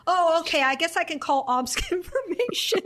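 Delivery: hammed-up, ending in a laugh; mock resignation. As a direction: play a reluctantly agreeable caller. Acting the exasperated president on phone.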